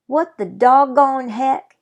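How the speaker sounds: cowboy gasping out his disbelief; startled cowboy. Acted. Tiny cowboy staring up from cupped hands, amazed and loud.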